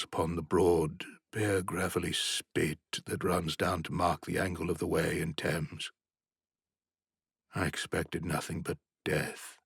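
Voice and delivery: ordinary delivery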